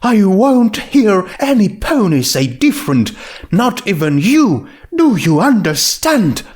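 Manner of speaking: a hint of firm, refined lady; lightly voiced Rarity, insisting.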